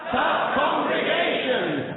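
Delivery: shouted, high and stretched out; loud slow call. Shown as a demonstration. Host leading the audience in a slow shouted reply. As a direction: roar it for the crowd. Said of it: "Let's just try that now".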